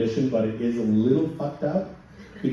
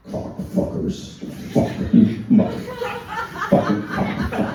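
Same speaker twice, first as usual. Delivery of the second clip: imitated tic outburst; slower than usual. Comic imitating a client's Tourette outburst on the phone, hoarse blurted tic. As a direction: spit the swears out like an uncontrollable tic.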